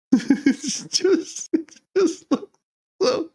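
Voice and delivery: words squeezed out high between laughs; giggling too hard to finish; laughing. Real, not acted. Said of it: "I can't stop laughing".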